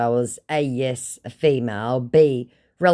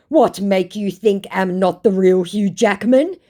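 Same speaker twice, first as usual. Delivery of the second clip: mock foreign scammer, higher and indignant; broken English; 7 semitones higher, 6 dB louder than usual. Scammer protesting he is real, mock broken English. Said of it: "His real English finally showing".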